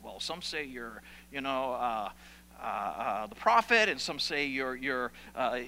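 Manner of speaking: low, halting mumble with fillers; hesitant. Acted. Disciples unsure how to answer Jesus, hesitant. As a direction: fumble softly for an answer, stalling.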